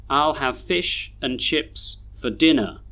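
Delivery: "and" weakened to un, "for" to fuh; slow model reading with reduced "and" and "for"; schwa weak forms. Shown as a demonstration. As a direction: stress the main words, say "and", "for" with schwa. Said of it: "we should read these sentences like this".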